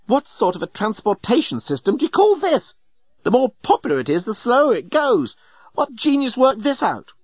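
high-pitched, indignant complaint. A narrator's reading. Journalist complaining to Nettie about the traffic jam, indignant. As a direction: rail indignantly, pitch leaping up. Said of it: "He was really quite indignant".

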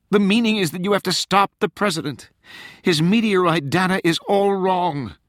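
high, exasperated plea; desperate woman on the phone; urgent young woman. Acted. Rachel role, urgent.

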